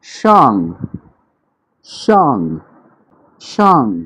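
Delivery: शांग falling sharply; शांग with a strong falling tone; fourth tone shang. Shown as a demonstration. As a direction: Mandarin 上 falling tone. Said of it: "word है".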